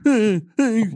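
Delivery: high, loud goofy henchman; loud falsetto hype man; put-on sidekick voice. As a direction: warn the bar in a high-pitched put-on voice. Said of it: "You gotta talk like this now".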